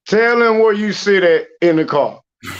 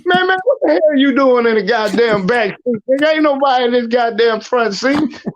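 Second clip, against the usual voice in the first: higher, exasperated scolding; mock scolding; 7 semitones higher than usual. Scolding the coworker about the back seat, exasperated. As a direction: scold him, half-laughing. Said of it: "I say".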